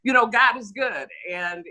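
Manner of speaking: ordinary delivery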